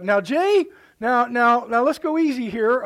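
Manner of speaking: high, loud protesting sister; sister objecting. Retold or imitated. Sister pushing back on cooking squirrel, high loud protest. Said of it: "she's like".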